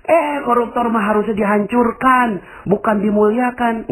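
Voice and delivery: high, indignant protest; objecting listener. Retold or imitated. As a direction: push back in disbelief. Jamaah membantah penceramah. (protes tinggi tidak terima)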